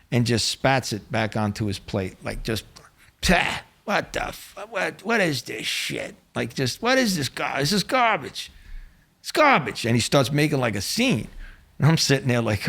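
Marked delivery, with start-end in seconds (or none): 3.2-9.8 mock disgusted spitting rant rising to a high yell; imitating a mobster spitting out bad meat; disgusted outburst. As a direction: spit the food out and rant, half-acted. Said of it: "he just starts screaming".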